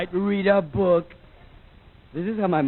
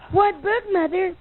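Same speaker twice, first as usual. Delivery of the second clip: put-on much higher prissy kid voice; 12 semitones higher than usual. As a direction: protest bedtime like a pampered boy, high. Kid asks mother.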